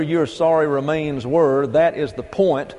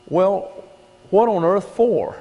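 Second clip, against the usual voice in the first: higher, incredulous question; mock disbelief; 6 semitones higher than usual. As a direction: act baffled by the order. Preacher mocking the odd command.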